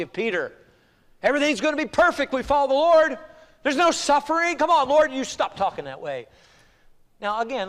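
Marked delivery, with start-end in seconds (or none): 1.2-6.3 mock believer's insistence, pitched high; high, pushy imitation of a believer; naive protest. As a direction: argue with the Lord in a raised voice. Voices believers protesting to the Lord.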